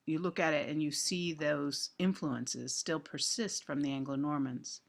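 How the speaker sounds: usual speaking voice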